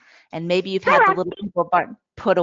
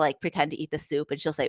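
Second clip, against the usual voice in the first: usual speaking voice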